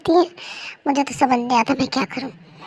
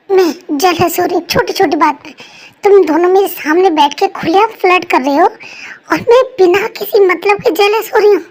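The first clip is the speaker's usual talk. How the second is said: louder, heated objection; indignant protest; 3 semitones higher, 5 dB louder than usual. Acted. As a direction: throw the accusation back at them. Wife protesting angrily.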